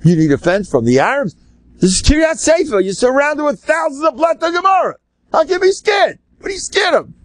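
high, shouting rebuke, pitch climbing; berserk rabbi. Retold or imitated. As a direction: rail at them in disbelief. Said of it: "Reb Chaim went berserk".

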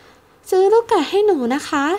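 put-on high, slow, rising child request; little-girl begging; polite little girl's plea. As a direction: ask sweetly and formally, like a child bowing. Child bowing, asking politely.

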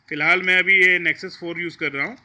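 ordinary talk, nothing marked

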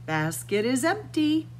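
plain reading voice

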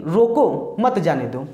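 "रोको", comma pause, then "मत जाने दो"; slow reading, split as "रोको, मत जाने दो"; pause after रोको. Shown as a demonstration. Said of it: "जो कॉमा लिखा है".

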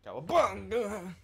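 slow, loud drawn-out cowabunga; Ninja Turtle orgasm. Pretend. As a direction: stretch it in mock climax. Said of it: "Ninja Turtle having an orgasm".

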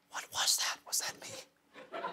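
a hushed, disbelieving whisper to yourself; stunned whisper. Retold or imitated. Remembering the trip, he whispers to himself in awe. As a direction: whisper it, slow and spooked, as if asking yourself. Retelling his own stunned reaction.

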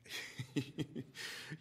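unvoiced sly laugh, barely there; a secret giggle only he hears; breathless silent laugh. Madman gloating in the dark.

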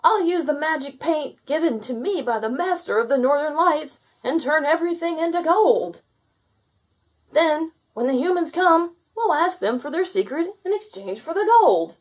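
high, loud gnome voice; shouted. A narrator's reading. Gnome laying out his gold plan, shouting. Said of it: "he shouted".

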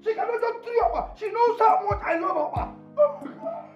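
high-pitched, hammed-up horror; over-the-top wailing indignation; outraged disbelief. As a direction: cry out in scandalized disbelief. Horrified at Opa being thrown away.

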